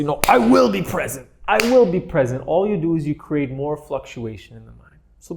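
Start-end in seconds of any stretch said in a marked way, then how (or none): 0.0-2.4 stern self-scolding, a touch louder and higher; mock strict self-reproach; berating. Shown as a demonstration. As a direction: scold yourself like cracking a whip. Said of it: "berate yourself and say".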